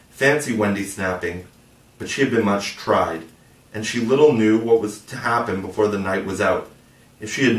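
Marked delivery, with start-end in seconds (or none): none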